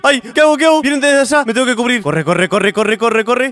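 panicked under fire; frantic, urgent muttering; being chased. Real, not acted. Under fire, running for cover.

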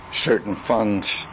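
ordinary talk

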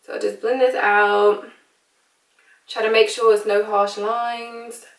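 put-on British accent, chatty makeup tutorial; American doing a casual British accent. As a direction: talk them through it in a playful British accent.

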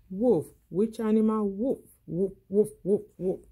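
put-on playful "woof" barks for kids; dog bark imitation. Shown as a demonstration. Teacher demonstrating animal sounds for children, playful barking.

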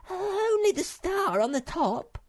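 very high, slow, loud squeak; little creature Sniff. Acted. Sniff owns up to Moomin Troll, squeaking high. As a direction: confess in a tiny creature's squeal.

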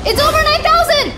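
high, loud, fast cry of amazement; excited shout. Acted. Gamer hits huge brain score, shouting in awe. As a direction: yell it like the power meter just broke. Brain power surges past limit.

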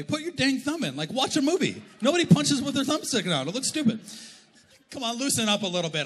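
high, loud comic outburst; exasperated rant. Acted. Preacher mocking bad punching technique, loudly exasperated.

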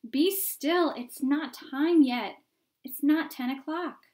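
high, loud fatherly shout; crying out. A narrator's reading. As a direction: shout to hold the children back. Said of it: "cried the fathers".

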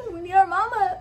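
real tears breaking through; real crying on we need our mama; getting emotional. Real, not acted. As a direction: voice cracks into real crying, high. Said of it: "I'm getting emotional".

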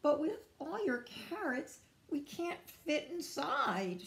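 high-pitched, mildly puzzled; bewildered Beaver. Acted. Beaver objects to all Rabbit's carrots, a bit bewildered. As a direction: pitch it up in mild confusion. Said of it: "a bit bewildered".

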